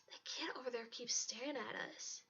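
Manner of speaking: soft, secretive whisper. A narrator's reading. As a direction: whisper it as a furtive warning. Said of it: "Bree whispered".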